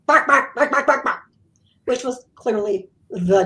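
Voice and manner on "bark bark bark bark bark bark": spoken mock dog barks, first ones loud; imitated barking. Medium barks like the dog at her client, mock barking. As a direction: bark it out like the dog. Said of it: "started barking at my client".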